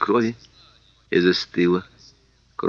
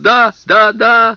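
high, loud shrieking; 14 semitones higher, 10 dB louder, much slower than usual. Acted. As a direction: shriek it out in terror. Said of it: "завизжал Сэм".

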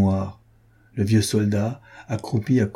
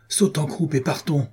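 exclaimed urging; 6 semitones higher than usual. A narrator's reading. La Guerche urges the old soldier to flee, restrained exclamation. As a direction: urge him along with a lifted, contained voice. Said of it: "s'écria monsieur de la Guerche".

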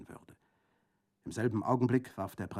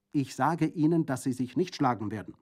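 higher, louder emphatic order; insisting; 4 semitones higher, 6 dB louder than usual. A narrator's reading. Prefect repeating his ban on duelling, insisting. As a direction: press the prohibition forcefully.

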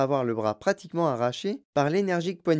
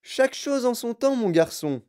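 high, smiling host reply; lilting, high-pitched indulgent answer; Wonka smiling; 9 semitones higher, 3 dB louder than usual. A narrator's reading. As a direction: put off a child's question with a smile. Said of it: "fit en souriant monsieur Wonka".

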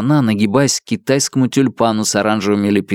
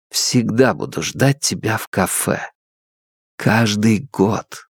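tender; 3 dB quieter, slower than usual. Acted. Quiet farewell promise.

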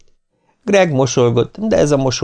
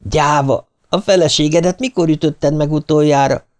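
higher-pitched, sniffling accusation; tearful taunt; 6 semitones higher than usual. A narrator's reading. Nancy turns sobbing on Martin, tearful high accusation. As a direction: accuse him through mild sobs. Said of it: "Nancy hüppögve fordult Martinhoz".

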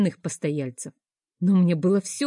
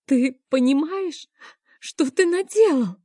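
high, louder, rising distress; anguished accusation; 7 semitones higher, 3 dB louder than usual. A narrator's reading. Mother accuses her child's father, high anguished cry. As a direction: throw the blame at him, voice cracking up. Heroine accuses Kerem in tears.

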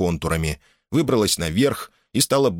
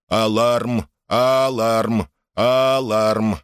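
monotone, evenly spaced alarm chant; robot's repeated alarm; 3 semitones higher, 3 dB louder, much slower than usual. A narrator's reading. Robot stamping in rhythm, droning its alarm. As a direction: drone each word in time with stamping. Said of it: "бормотал он, притаптывая в такт".

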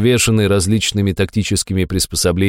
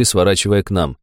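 usual speaking voice

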